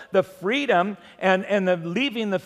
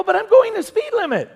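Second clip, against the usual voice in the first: high, whining mock protest, a bit louder; whiny protest; 13 semitones higher, 4 dB louder than usual. Young driver whining back at the officer, high and petulant. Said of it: "I go".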